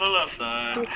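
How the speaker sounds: high, soft, dopey cartoon bellow; ring announcer. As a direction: call it like a goofy fight announcer. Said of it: "booming voice".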